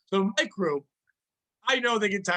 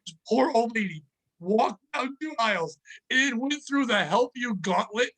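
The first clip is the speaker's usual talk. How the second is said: ordinary delivery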